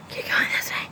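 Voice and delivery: hushed unvoiced whisper. Real, not acted. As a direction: whisper the directions. Whispered aside.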